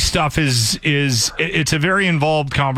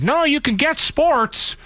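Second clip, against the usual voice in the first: mocking voice; 13 semitones higher, slower than usual. Mocking what people told him.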